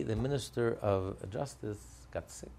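normal talking voice

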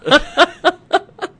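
high-pitched burst of laughter; loud high giggling fit; real laughter. Real, not acted. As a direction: crack up loudly, high and helpless. Cracks up at cohost's remark.

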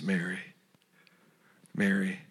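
low, soft retelling of a name being called; hushed, low and intimate; tender calling. Retold or imitated. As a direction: say the name gently and quietly, leaving space after it. Jesus tenderly calls Mary's name.

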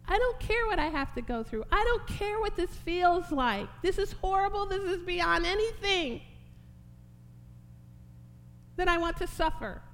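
high, loud cry of resolve to the Father; fervent, full-voiced surrender; impassioned Jesus. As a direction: plead with rising force, building to a shout. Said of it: "What's he saying there?".

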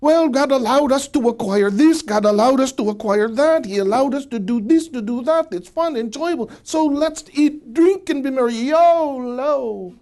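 hammed-up live-for-today enthusiasm; carefree YOLO believer. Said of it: "they would say".

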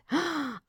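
high, sharp intake of breath; excited gasp. A narrator's reading. Mouse learns of the visit, gasping excitedly. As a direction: gasp like a thrilled little mouse. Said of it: "The little mouse was greatly excited".

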